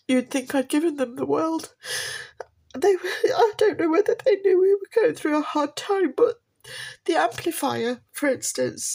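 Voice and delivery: tearful laugh breaking through the words; teary laugh-cry; crying while talking. Real, not acted. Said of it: "Let me have a minute".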